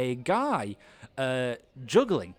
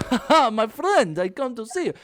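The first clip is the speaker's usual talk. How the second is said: high, loud, put-on friendliness; showman's greeting; 10 semitones higher, 9 dB louder than usual. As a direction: greet a stranger loudly for the crowd's benefit. Showman loudly greets the player.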